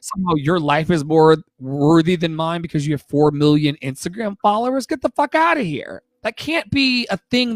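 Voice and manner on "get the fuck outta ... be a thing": incredulous scoff. Real, not acted. Scoffing at follower-count worth.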